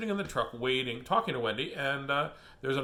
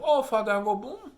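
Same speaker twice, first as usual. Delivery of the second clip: put-on sing-song baby talk, a bit higher; talking to a little kid; 7 semitones higher, 4 dB louder than usual. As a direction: coo it like to a toddler. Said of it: "You say, you say that to a little kid".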